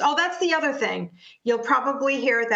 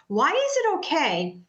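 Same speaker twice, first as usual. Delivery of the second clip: ordinary talk, nothing marked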